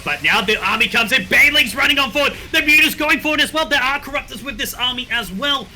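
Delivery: fast, rising esports casting; excited play-by-play. Acted. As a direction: call the fight as it builds.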